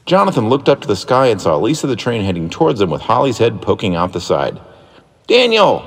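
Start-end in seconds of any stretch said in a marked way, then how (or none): 5.3-5.8 high, loud call of "Daniel"; a boy yelling his brother's name; yelled name. Acted. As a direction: shout to get his brother's attention. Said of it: "yelled Jonathan".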